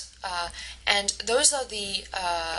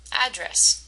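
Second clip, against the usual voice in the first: "Address" as AD-dress, AD higher; first-syllable stress; 4 semitones higher than usual. Shown as a demonstration. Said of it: "If you said the first part".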